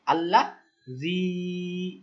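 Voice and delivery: জি held very long and slow; long madd. Shown as a demonstration. Quran teacher modelling long ইয়া মাদ on জি for students. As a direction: stretch the জি out, madd lengthening. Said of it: "এটা হলো কিন্তু মাদ".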